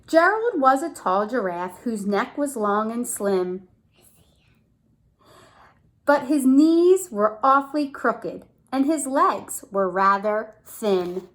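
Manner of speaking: lively storybook read; reading to children. Acted. Mother reading to her son, lively storybook voice. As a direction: read it out to a small child with bounce. Reads picture book to child.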